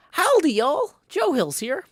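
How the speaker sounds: loud, high-pitched yipped greeting; wolf yip. Shown as a demonstration. Streamer showing the wolf noises so far, yipping loudly. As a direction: yelp the hello like a wolf. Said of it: "So far, we've got".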